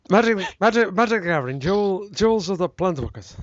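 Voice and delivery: hammed-up Romanian-ish accent; mock Romanian accent. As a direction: playfully slip into a vague Romanian accent. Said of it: "sound Romanian or something there".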